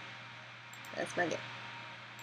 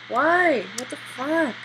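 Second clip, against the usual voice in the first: startled; 3 semitones higher, 6 dB louder, slower than usual. Real, not acted. Startled by game event.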